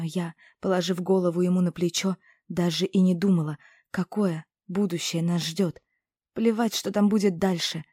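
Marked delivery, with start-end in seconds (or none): none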